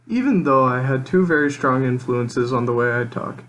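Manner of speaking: normal talking voice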